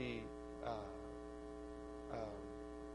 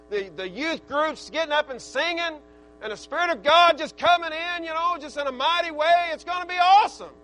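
preaching that builds higher and louder; fired-up preaching; 4 semitones higher, 7 dB louder than usual. Real, not acted. Preacher looking forward to the youth meeting, fired-up preaching. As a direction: build to a high, loud peak of excitement.

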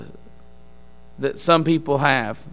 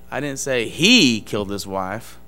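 single out the word "he" with a raised pitch; stress on HE; 4 semitones lower than usual. Shown as a demonstration. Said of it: "Where you emphasize the word".

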